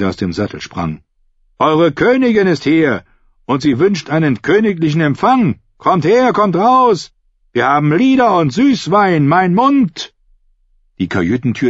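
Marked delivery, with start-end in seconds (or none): 1.6-10.1 hoher, schwungvoller Ausruf; kündige die Königin der ganzen Mannschaft an; rufend. A narrator's reading. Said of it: "rief er". (lautes, hohes Rufen)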